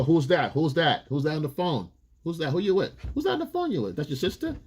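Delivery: mock jealous grilling, rising shrill; jealous girlfriend.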